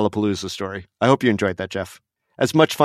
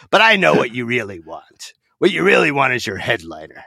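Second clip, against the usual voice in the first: teasing showman. Retold or imitated. MC teases the crowd.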